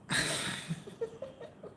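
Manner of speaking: squealing high laugh; delighted laugh. Real, not acted. Laughing at a viewer's cheeky question, squealing giggle. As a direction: burst into a shrill giggle. Viewer asks if she is fit.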